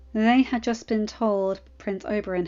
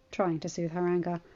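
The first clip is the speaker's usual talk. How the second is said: ordinary delivery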